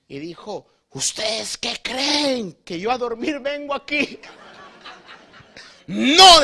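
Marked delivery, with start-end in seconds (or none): 0.9-4.2 high, slow, indignant question; hammed-up huffy indignation; offended. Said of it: "Y se enojó". 5.9-6.4 a high, loud furious "no"; hammed-up outraged no; angry denial. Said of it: "Dijo, se enojó".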